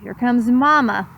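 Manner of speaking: usual speaking voice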